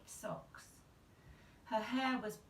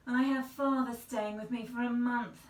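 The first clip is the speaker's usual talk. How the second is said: louder, higher prim fretting; fussy aunt; 4 semitones higher, 8 dB louder than usual. Acted. Prim aunt confiding to the mother, fussy. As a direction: fuss like a tidy little lady.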